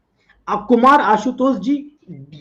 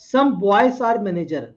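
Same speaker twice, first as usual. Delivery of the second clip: slow statement read; much slower than usual. Shown as a demonstration. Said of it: "reading the statement slowly".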